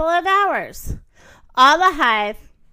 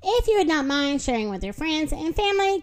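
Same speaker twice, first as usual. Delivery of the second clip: ordinary delivery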